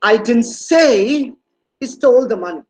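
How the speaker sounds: "say" stretched long and pushed up, then a pause; slow, swelling emphasis on "say"; stress on SAY. Shown as a demonstration. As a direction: lean heavily on the word "say". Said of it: "When you use the stress".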